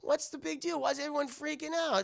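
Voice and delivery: high-pitched, bewildered protest; mock bafflement. Boy baffled by fleeing crowd.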